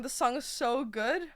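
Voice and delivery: ordinary delivery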